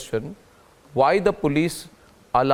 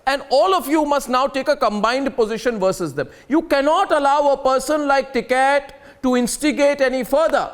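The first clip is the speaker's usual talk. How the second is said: heated shouting; 8 semitones higher than usual. Real, not acted. Anchor overriding panelists with angry, high-pitched shouting. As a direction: hammer each line at top volume to shut down the debate.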